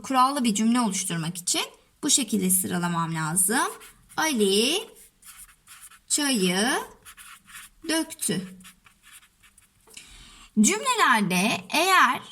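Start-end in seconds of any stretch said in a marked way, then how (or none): 4.2-8.6 isolated words with long pauses; dictating while writing. Shown as a demonstration. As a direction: dictate one word at a time. Says words while writing them.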